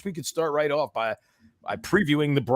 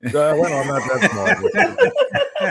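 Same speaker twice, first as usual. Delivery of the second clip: drawn-out, louder, breaking into a laugh; 3 semitones higher, 6 dB louder, much slower than usual. Real, not acted. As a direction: protest through laughter. Teased about height, laughing.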